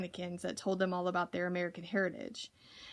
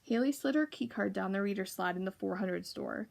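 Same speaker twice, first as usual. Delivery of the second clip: usual speaking voice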